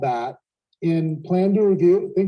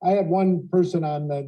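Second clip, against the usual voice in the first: ordinary delivery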